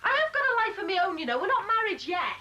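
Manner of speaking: high, fast, indignant outburst; angry retort. Acted. Michelle defends her night out to Terry, angry. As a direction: fire back fast, voice pitched right up. Quarrel peaks.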